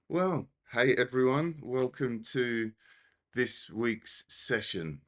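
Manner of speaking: breathless, slowed greeting; catching her breath while talking. Real, not acted. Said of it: "I sound a little bit out of breath".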